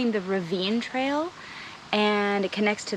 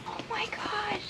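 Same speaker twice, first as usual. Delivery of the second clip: hushed, high, amazed whisper; excited whisper; 8 semitones higher, 7 dB quieter than usual. Real, not acted. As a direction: whisper the thrilling discovery, barely containing it. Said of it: "I don't know why I'm whispering".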